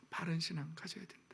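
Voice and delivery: soft unvoiced whisper; whispered conviction. Real, not acted. Pastor urging right faith, whispered. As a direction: drop to a whisper to land the point.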